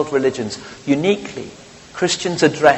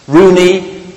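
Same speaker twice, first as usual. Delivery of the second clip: quoted headline; 6 semitones higher, 8 dB louder than usual. Retold or imitated. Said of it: "says Ericsson".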